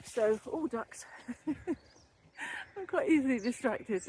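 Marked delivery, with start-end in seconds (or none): 1.2-4.0 laughing. Real, not acted. Laughing at her own tangent.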